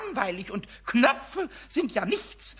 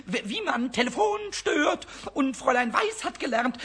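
usual speaking voice

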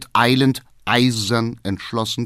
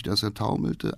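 usual speaking voice

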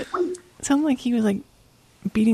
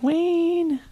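put-on little girl voice; 8 semitones higher than usual. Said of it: "She's like".

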